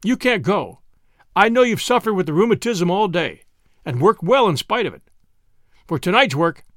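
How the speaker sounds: captain assigning the boastful cook to danger; lightly voiced captain. A narrator's reading. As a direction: give the order crisply. Captain questions Stokes.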